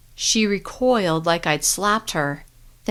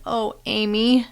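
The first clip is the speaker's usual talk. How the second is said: muffled; 4 semitones higher than usual. Acted. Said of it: "My voice was muffled".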